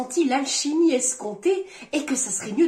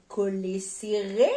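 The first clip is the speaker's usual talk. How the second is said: slow, low and drawn out, rising at the end; teasing wordplay; 4 semitones lower, 5 dB quieter, much slower than usual. Acted. Partner pestering a reader, slow low teasing. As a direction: stretch the pun suggestively into a question.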